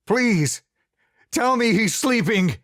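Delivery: high, loud desperate plea; terrified pleading. A narrator's reading. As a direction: beg him to deny it. Quiet terror in his voice.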